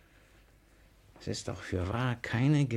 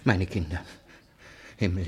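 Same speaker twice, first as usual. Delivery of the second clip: ordinary talk, nothing marked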